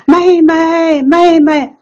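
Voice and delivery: high, carrying call of 妹妹 across the river; brother calling out. Retold or imitated. Brother calling after his little sister, high and loud. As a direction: shout 妹妹 to the little sister running off.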